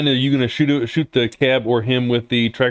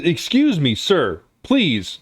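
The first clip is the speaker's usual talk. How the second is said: raised, prim protest from a harried man; flustered gentleman; 7 semitones higher than usual. Acted. GM voicing a man pushing off a coat-grabber, flustered. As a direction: fend off the grabbing stranger, politely fussed. GM voices NPC pushed at cab.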